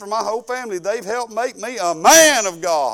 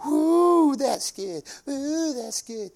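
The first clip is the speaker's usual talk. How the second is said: lightly voiced young woman; 5 semitones higher, much slower than usual. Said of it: "she's going".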